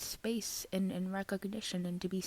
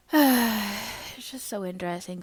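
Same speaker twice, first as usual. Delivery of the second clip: sighing reflection; 3 semitones higher, 8 dB louder, slower than usual. Real, not acted. Sighs reflectively.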